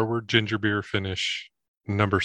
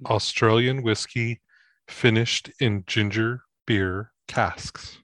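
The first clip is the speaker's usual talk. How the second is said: label read; slower than usual. Real, not acted. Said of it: "reads bottle label slowly".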